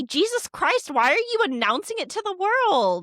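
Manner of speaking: high-pitched indignant protest; hammed-up exasperated comeback; acted exasperation. Acting out exasperated reply to accusers.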